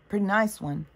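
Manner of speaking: ordinary delivery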